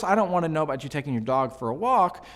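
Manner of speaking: ordinary talk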